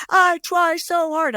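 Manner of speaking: high, drawn-out wail in imitation; mock scream. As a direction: stretch it into a very high mock shriek. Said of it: "screaming at the top of her lungs".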